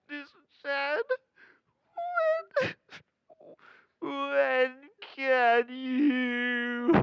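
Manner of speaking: put-on high, tearful little-boy voice; imitating a crying child. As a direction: squeeze the words out through tears.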